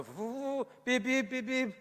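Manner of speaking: child's pretend engine and high hooting horn; imitated toy car. MP mocking children playing at driving, imitated car noises. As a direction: mimic a car, rising to high beeps. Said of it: "udlala uba ngutata nomama okanye uqhuba".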